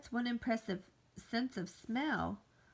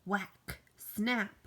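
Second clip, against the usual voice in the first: usual speaking voice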